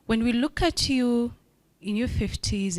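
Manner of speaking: usual speaking voice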